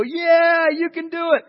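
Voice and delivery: high, loud crowd cheer; excited crowd hollering encouragement. Acted. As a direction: cheer him on like a crowd at the falls. Crowd cheering the tightrope walker.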